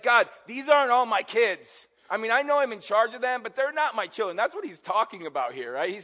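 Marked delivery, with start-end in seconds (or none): 0.0-4.4 higher and louder, exasperated sarcasm; sarcastic complaint. Retold or imitated. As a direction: throw it back at God like a fed-up parent. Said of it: "he's kinda sarcastic with God".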